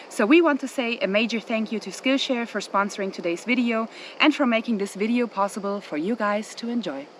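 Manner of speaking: upbeat sponsor read voice. Acted.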